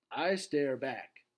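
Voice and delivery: usual speaking voice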